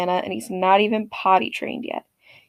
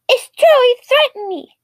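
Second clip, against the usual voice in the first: put-on high, louder childish whine; whiny kid complaint; 13 semitones higher, 6 dB louder than usual. Entitled kid complains to mom, high childish whine. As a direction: complain like a spoiled little boy.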